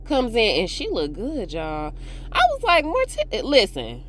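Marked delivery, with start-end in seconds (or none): 2.3-3.4 high excited squeal; high-pitched gush. Real, not acted. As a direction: squeal her name out. Excited about Morticia's look.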